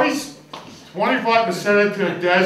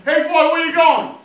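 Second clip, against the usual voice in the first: calling out; 9 semitones higher, 6 dB louder than usual. Acted. Woman calling after him.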